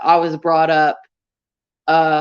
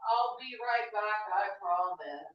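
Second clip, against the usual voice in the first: clingy plea, quicker and off mic; begging an ex back; 16 dB quieter than usual. Pretend. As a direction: promise you'll return, needy. Pleading as she leaves.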